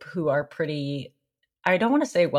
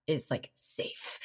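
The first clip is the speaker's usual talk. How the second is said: whispered; 3 semitones lower, 11 dB quieter, much slower than usual. Real, not acted. Whispering an aside about dating safety.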